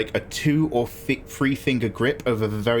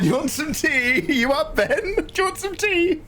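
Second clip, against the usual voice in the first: high, sweet mum voice; imitating mum. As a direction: sing it like a kindly mum. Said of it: "my mum would be like".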